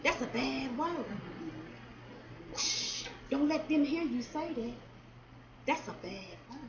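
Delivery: a hint of an elder hushing a boy, sharp then hushed; mild mock elder warning, dropping to a hush; old folks scolding. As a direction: shush the kid like a worried grandma, lightly voiced. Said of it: "the old folks used to say".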